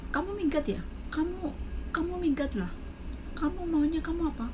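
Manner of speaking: high, accusing boss voice; shrill-free mock scolding, high; mock angry boss. Angry employer voiced in retelling.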